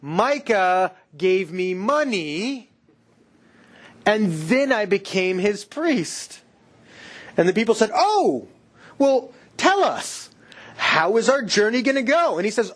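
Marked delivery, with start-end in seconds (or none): 0.0-6.4 very slow, stretched-out mock boast; hammed-up smug priest, every phrase drawn out; telegraphing Levite. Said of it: "as if you can hear him saying". 7.9-12.4 high, drawn-out mock interest; impressed questioners. People play along with Levite.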